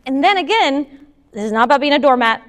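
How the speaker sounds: ordinary talk, nothing marked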